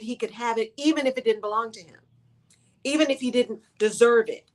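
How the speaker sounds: ordinary delivery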